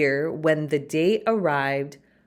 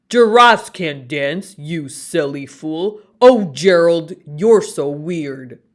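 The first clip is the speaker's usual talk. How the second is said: loud sneering taunt; mock the clumsy giraffe out loud; 2 semitones lower, 7 dB louder than usual. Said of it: "the animals all sneered".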